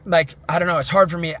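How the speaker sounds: ordinary delivery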